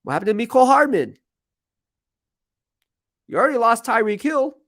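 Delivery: high, rising mock alarm; hammed-up disbelief, pitched right up; mock dismay. Host needling Chiefs fans over lost players, mock dismay.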